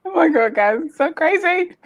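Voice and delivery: high, bubbly gush; giddy disbelief. Real, not acted. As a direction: squeal it out in happy disbelief. Reacting to praise of her growth.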